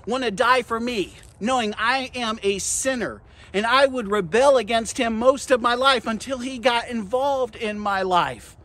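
impassioned sermon delivery, key words punched; full-force preaching. Acted. Preacher warning the congregation against pride, full-force preaching. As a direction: drive each point home to the congregation.

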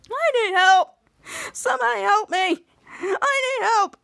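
high, strained cries for help; acted yelling. Ray coming into the Nacho Ball, high panicked yelling. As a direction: cry out high, in mock panic. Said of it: "yelling and screaming".

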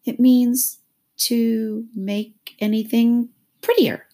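high, slow, halting answer; doubtful Alice. A narrator's reading. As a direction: feel for each word. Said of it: "said Alice doubtfully".

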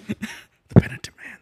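breathy movie whisper. Pretend. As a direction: whisper it like a secret.

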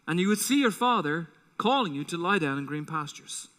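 normal talking voice

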